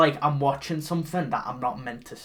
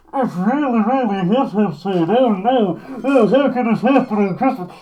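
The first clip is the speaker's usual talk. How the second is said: higher, louder, smothered mock lament; muffled Santa impression; 5 semitones higher, 7 dB louder than usual. Podcaster showing the muffled film audio, higher mock lament. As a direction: sound like bad film audio. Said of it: "so muffled like this".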